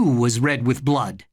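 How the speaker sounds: ordinary delivery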